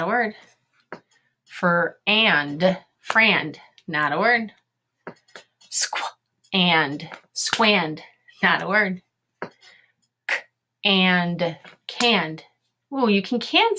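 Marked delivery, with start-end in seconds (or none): 1.4-3.6 Fr-, and, then blended frand; teacher blending frand from its sounds; sounding out. Shown as a demonstration. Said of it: "Here we go". 5.7-8.0 Squ-, and, then blended squand; teacher blending squand from its sounds; sounding out. Shown as a demonstration. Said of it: "Here we go". 10.3-12.4 K-, and, then blended canned; teacher blending canned from its sounds; sounding out. Shown as a demonstration. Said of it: "Here we go".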